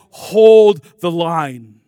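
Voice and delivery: very loud, drawn-out command; long rallying shout; Benjamin Martin's battle yell. Retold or imitated. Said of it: "and he yells".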